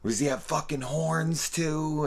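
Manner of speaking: high-pitched furious yell at nobody in particular; angry yelling. Retold or imitated. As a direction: play the captain losing it at the office. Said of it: "yelling at random people".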